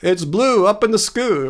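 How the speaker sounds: "sky" turned into "skoo"; mock mispronunciation. Streamer teasing a viewer, joking "skoo" for sky. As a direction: rhyme "skoo" with blue. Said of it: "It's not blue up in the skoo".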